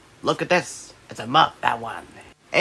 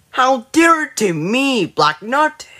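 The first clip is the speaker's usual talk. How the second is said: mock indignant; 10 semitones higher, slower than usual. Child scolding toy knight.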